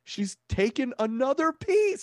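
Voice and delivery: high, slow, scandalised gasp; outraged disbelief. Acted. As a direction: read it like you're scandalised. Reading outraged tweet aloud.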